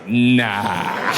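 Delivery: low, drawn-out refusal; dismissive refusal. Acted. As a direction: turn it down with mock finality. Acted refusal of safari.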